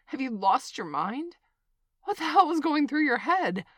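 angry yell. Acted. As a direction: yell it at her, outraged. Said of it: "I'm sorry for yelling".

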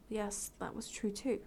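usual speaking voice

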